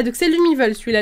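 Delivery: normal talking voice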